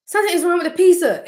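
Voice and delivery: high, loud insisting; insistent. Retold or imitated. Telling her family the pizza is wrong, high and insistent. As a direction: insist something is off.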